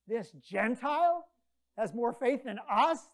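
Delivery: preacher stressing it to the congregation; emphatic indignation. Acted. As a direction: hammer home the shock.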